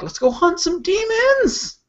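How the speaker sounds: high, drawn-out pretend war cry; mock battle shout. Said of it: "just shouting".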